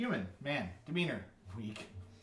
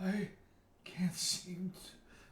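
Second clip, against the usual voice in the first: put-on old man voice trailing off; 7 semitones higher, much slower than usual. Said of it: "His voice trails off".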